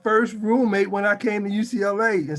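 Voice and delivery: name called out high and loud; excited guest introduction. Real, not acted. Host revealing his old roommate as today's guest, thrilled. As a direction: announce the guest like a crowd favourite.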